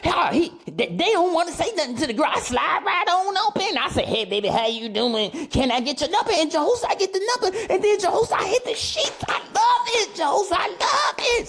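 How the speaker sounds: very high, gleeful mock bachelor voice. Host imitating a bragging bachelor, high gleeful mock voice. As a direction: squeal it like a smug playboy bragging. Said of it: "the bachelor".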